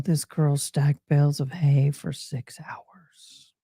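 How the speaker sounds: slow, unvoiced confiding whisper. Real, not acted. Telling the audience about stacking hay, a slow whisper. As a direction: whisper it like a proud secret. Confiding her hard work.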